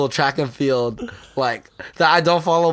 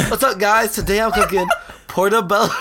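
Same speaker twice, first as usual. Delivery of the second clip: higher-pitched cooking host imitation, cracking up; Zach; 6 semitones higher than usual. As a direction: mimic a video intro through laughter. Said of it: "he's like this".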